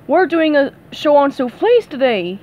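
hurried, worried producer voice; lightly voiced nervous boss; anxious. Sam tells SpongeBob about the show, a touch anxious. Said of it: "he said anxiously".

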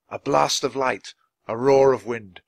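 ordinary delivery

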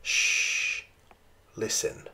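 soft shh, almost no voice; hushed whisper. A narrator's reading. Mother leaning down to hush her daughter, whispering. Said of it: "Maggie's mother leaned down".

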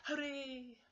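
very high, loud cub cheer; excited cheer. Acted. Baby Bear hears of a new chair, high cheering. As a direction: squeal it out in delight. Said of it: "cheered Baby Bear".

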